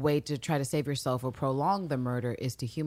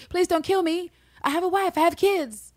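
mock pleading victim; 13 semitones higher, 4 dB louder than usual. Mocking victims' stock plea.

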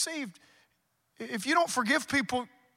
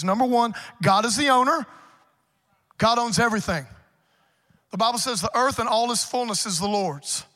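loud, driving sermon delivery; preaching at full force; 6 dB louder than usual. Acted. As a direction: preach it hard to the congregation.

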